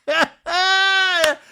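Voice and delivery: high, loud burst of laughter; real delighted shriek of laughter; loud cackle. Real, not acted. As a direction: cackle loudly at a wicked line. Host cackles at the retold insult.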